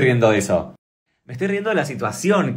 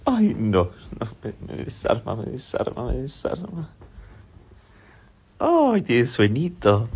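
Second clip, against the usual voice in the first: tender cooing; 7 dB quieter than usual. Real, not acted. Mother cooing softly to her puppy at dawn, tender baby talk. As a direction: coo lovingly over a sleepy little one.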